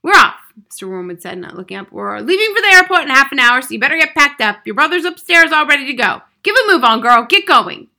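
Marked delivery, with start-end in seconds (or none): 0.0-0.5 loud, raised, abrupt announcement; brusque father. A narrator's reading. Gruff father in chaotic hurry. 1.9-7.9 loud, high, urgent orders; hurried bossy father. A narrator's reading. Father ordering daughter to pack.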